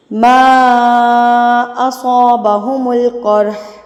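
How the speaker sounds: slow, drawn-out melodic tilawat; Quran recitation. Shown as a demonstration. Teacher recites the verse in tilawat style. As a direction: recite with long stretched vowels.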